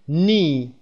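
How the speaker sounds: "نی" for knee, drawn out with no k; silent K. Shown as a demonstration. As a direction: say "نی" slowly, starting straight on n. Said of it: "کی تلفظ نمیشه".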